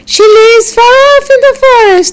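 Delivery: put-on high sweet little girl voice; a small girl chirping up at the wolf. Little girl answers the wolf, high and sweet.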